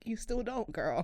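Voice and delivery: soft, whispered tease; hushed aside. Real, not acted. Host teasing guest about the father, hushed aside. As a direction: slip it in under your breath.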